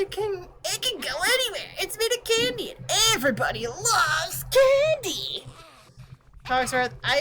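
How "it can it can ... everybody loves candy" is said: put-on high, fast, offended kid voice; indignant girl. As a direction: snap back at the pompous clock, pitched high. Girl defends candy house.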